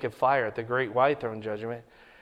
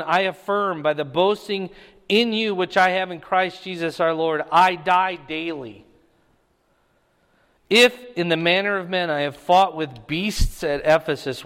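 louder, raised preaching read of the passage; scripture reading; 2 semitones higher, 5 dB louder than usual. Acted. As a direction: proclaim the verses to the congregation. Reads scripture aloud in sermon.

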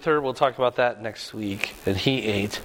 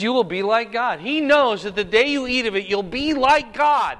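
high serpent voice; 7 semitones higher than usual. Retold or imitated. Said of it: "He says".

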